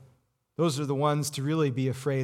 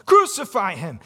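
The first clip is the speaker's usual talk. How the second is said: raised, louder crucify him; crowd shouting; 4 semitones higher, 6 dB louder than usual. Retold or imitated. The crowd demanding crucifixion, shouting. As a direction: build the chant up to a shout. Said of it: "And he said".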